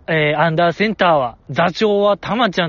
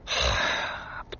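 defeated sigh; 12 dB quieter than usual. Real, not acted. Overwhelmed by the score gap.

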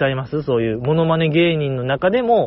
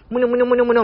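high, rapid nonsense babble; villager voice imitation; 8 semitones higher, much faster than usual. Imitating an Animal Crossing villager, in fast high gibberish. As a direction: chatter like a little game villager. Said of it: "村人の声真似？".

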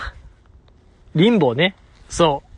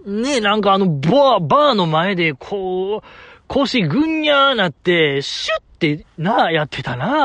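higher, gushing mock Kansai dialect to himself; fake Kansai gushing; 3 semitones higher than usual. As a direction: gush like a pretend Kansai fan talking to himself. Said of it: "変な関西弁出るんですから". (一人で盛り上がる高めのエセ関西弁)

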